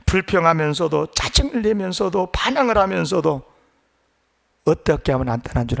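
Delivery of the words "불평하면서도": ordinary delivery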